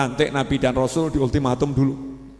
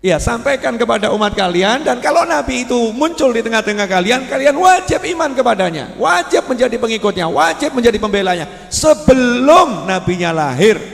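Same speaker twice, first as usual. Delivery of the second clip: raised, louder preaching voice, pressing each wajib; full-force preaching; 9 semitones higher, 8 dB louder than usual. Acted. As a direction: preach it like a rising call to the faithful. Sermon preaching climax.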